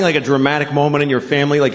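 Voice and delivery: ordinary delivery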